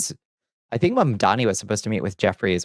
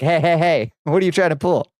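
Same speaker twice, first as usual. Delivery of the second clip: high, louder mock gatekeeper objection; mock challenge; 7 semitones higher, 3 dB louder than usual. Gatekeeper stopping someone with pointy ears, mock challenge. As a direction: call them out like a suspicious bouncer, pitched up.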